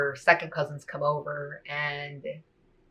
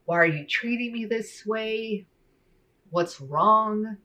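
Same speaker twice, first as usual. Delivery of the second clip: higher, pleading voice of a hurt dad; hurt father; 6 semitones higher, slower than usual. Retold or imitated. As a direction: plead like a father who can't understand his child. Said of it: "he was like".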